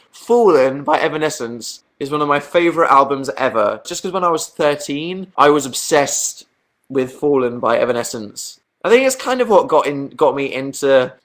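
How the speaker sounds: ordinary delivery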